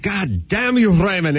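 put-on high, scolding German-accented mom; mock cursing in a German accent; crazy German accent. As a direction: imitate an exasperated mother with a German accent. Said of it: "his mom had a crazy German accent".

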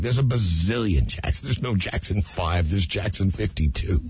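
usual speaking voice

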